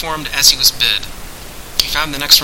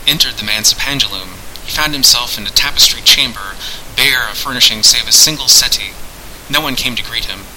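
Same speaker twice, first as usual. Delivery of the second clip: plain reading voice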